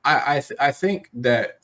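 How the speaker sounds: normal talking voice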